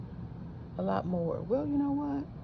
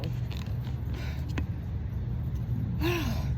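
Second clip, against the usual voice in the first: long, breathy tired sigh; weary sigh; 8 semitones higher than usual. Real, not acted. Tired sigh after back pain.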